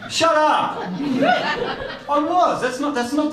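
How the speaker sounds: defending himself to a laughing crowd, hammed-up; mock indignant. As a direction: pretend to be offended by the laughter.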